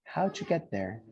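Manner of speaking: "How'd you get there?" with "How'd you" blended to "howju"; pronunciation model. Shown as a demonstration. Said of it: "You guys say with me".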